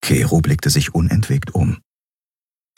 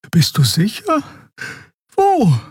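much higher, rising alarmed questions; startled Xea. A narrator's reading. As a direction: jump up in pitch with alarm. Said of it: "Xea startled".

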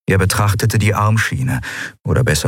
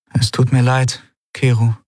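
higher, gentle apology; apologetic heroine; 6 semitones higher than usual. A narrator's reading. Endriel apologises to Kero for his killing, apologetic. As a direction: voice a woman saying sorry.

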